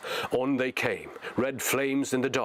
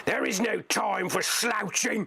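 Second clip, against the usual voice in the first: much higher, slow menacing orc; orc shouting; 12 semitones higher than usual. Acted. Orc driver bullies the slouchers, high slow orc threat. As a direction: drag each word out as a threat. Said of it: "he cried".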